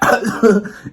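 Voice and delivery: loud, high hacking cough; sudden forceful retching cough; imitated coughing fit. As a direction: act out coughing up a tube. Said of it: "it's gonna be a cough".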